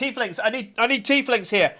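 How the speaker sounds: high, loud call for help; urgent shout. Acted. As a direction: shout for them to come quick. Navigator shouts urgently for tieflings.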